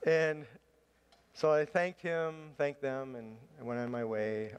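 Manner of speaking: ordinary delivery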